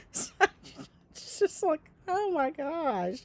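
words squeezed through laughter, high; laughing. Real, not acted. Laughing to a friend at the memory, breathless and high. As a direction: laugh it out, slow. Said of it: "laughing at the memory".

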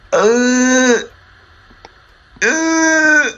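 high, loud startled uhs; startled gasps. Real, not acted. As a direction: yelp from a jump scare. Jump scare reaction.

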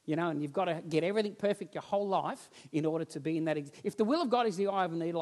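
ordinary delivery

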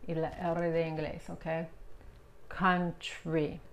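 normal talking voice

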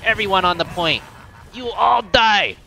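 loud, high villain battle cry; hammed-up taunt at the enemy team; roleplay battle cry. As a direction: roar it like a gloating boss. Hero taunt shouted in game.

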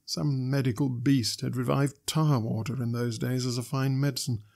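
ordinary delivery

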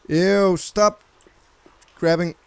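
ordinary talk, nothing marked

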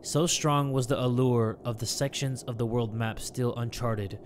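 low, soft, measured reading; journal narration. Acted. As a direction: read it as a reflective diary voice-over. Reads journal.

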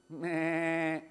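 a drawn-out sheep meh; sheep bleat. Acted. Boy imitating the sheep for the children, bleating. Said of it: "Do you know what sheep do?".

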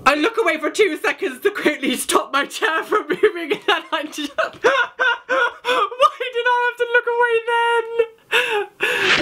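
very high, squealing, near-crying complaint; laughing wail. Real, not acted. Player who looked away at the scare, laughing wail. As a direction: wail helplessly through laughter, stretching the last words.

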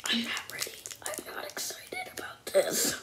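hushed nervous whisper. Real, not acted. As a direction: whisper it under your breath. Nervous before tasting sour candy.

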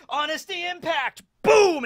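high, loud battle cry; attack shout. Retold or imitated. Kobe unleashing his attack, high and shouting. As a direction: yell the move name with full force. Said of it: "we'll see him use the Honesty Impact".

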